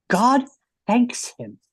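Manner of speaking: ordinary talk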